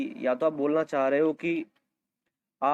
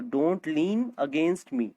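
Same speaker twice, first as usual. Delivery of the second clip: slow model sentence; much slower than usual. Shown as a demonstration. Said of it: "slow model sentence for learners".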